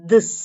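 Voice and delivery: திஸ் with a voiced, dh-like th; light TH. Shown as a demonstration. Teacher contrasting two th sounds for learners, light. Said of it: "கொஞ்சமா உச்சரிக்கப்படுற".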